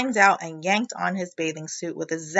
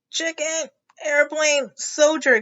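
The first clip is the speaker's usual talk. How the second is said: higher breathless gasping words; gasped; 7 semitones higher, 3 dB louder than usual. A narrator's reading. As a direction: gasp each word like you're short of air. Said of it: "gasped Froggy".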